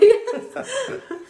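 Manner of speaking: high, loud burst of laughter. Real, not acted. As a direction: laugh out at the hiccup. Laughing at own hiccups.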